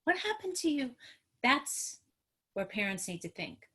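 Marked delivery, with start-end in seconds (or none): 0.0-0.9 wondering question. Shown as a demonstration. Parent modelling trauma-informed thinking, wondering. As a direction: ask with open curiosity.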